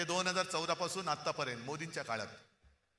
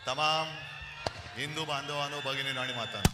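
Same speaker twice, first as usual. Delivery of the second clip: rally speech; 4 semitones lower than usual. Real, not acted. Opening address to rally crowd.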